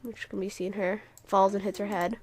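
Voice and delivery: ordinary delivery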